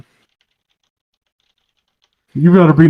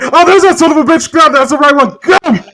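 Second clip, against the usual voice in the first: high, fast mock thug shouts; thugs outside; 9 semitones higher, 4 dB louder, faster than usual. Friends retelling a story, mock thug yelling. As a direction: ham up the guys spotting him outside. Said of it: "the guys are outside like".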